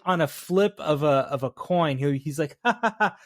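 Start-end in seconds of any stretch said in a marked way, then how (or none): 2.6-3.2 high mock laugh in bursts; charming laughing. Cop laughing along before he turns, charming. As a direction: put on a chummy ha-ha-ha. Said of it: "he's laughing with them".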